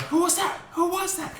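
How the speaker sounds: high-pitched imitation of an outraged teacher; mock teacher demand. Teacher turns around on the kids, high mock outrage. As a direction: squawk it as the indignant teacher. Teacher whirls round after rubber band.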